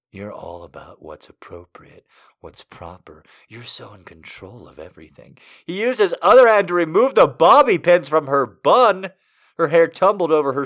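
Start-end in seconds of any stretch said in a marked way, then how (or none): 0.1-5.4 low, soft, seductive man; seductive whisper. Acted. Seductive male voice.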